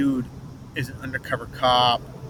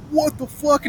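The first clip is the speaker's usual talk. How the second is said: high, startled what the fuck; retold shock, pitched up; shocked outburst; 11 semitones higher, slower than usual. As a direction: blurt it out in disbelief. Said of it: "I'm like".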